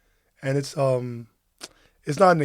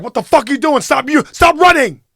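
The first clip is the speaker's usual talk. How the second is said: put-on yelling high and loud at the running kids; furious shout; 11 semitones higher, 11 dB louder than usual. Stranger on her phone screaming at wife and son, furious shout. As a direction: scream it at them as they run past. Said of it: "she was like".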